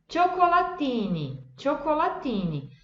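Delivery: cioccolatini with cho start and hard ti; Italian ci and ti. Shown as a demonstration. Teacher models cioccolatini. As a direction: say cioccolatini as cho-co-la-tee-nee. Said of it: "Viram a diferença?".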